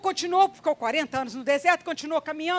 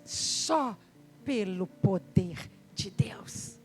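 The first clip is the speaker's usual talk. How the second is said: slow whispered awe; 6 semitones lower, 3 dB quieter, much slower than usual. Real, not acted. Preacher whispers the power of God to the church. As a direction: breathe it out in hushed reverence.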